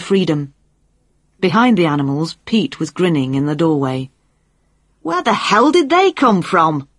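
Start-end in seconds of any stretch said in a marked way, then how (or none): 5.0-6.9 high, raised-voice outburst; startled yell. A narrator's reading. Jane startled by loose animals behind her, yelling at Pete. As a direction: yell it in alarm, pitch jumping up. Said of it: "Jane yelled".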